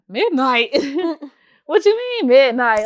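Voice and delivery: incredulous, with a laugh; retold baffled protest, drawn out; mock disbelief. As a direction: question it like it's absurd. Retelling disbelief at late meeting time.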